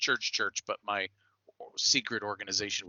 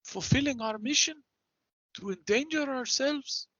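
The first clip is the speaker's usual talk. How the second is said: higher, formal character voice, measured phrases; cautious party member in character; 13 semitones higher than usual. Acted. Warning a proud companion against risking the mission, solemn in character. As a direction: reprove a companion in a lofty, higher voice. Player speaks as their character.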